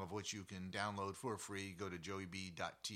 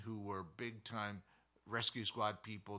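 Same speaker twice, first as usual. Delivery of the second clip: normal talking voice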